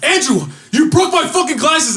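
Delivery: high, loud put-on wail; a kid hamming it up for the teacher; mock outraged yell. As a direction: overplay it so the teacher hears. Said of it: "I'm like".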